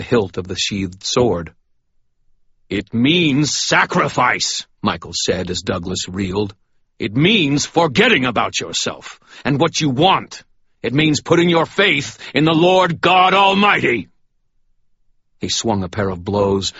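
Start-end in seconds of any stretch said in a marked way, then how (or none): 2.7-4.7 slow, high and loud, like a preacher; booming righteous rebuke; stern sermon. Acted. Michael lectures beaten priest. 7.0-14.1 booming righteous rebuke; stern sermon. Acted. Michael preaches at Douglas.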